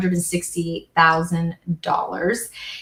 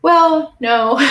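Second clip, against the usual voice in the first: a sudden loud "well", then "no"; emphatic no; 8 semitones higher, 5 dB louder than usual. Retold or imitated. Mom corrects her son with a loud no. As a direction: cut him off with a firm, loud no. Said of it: "I was like".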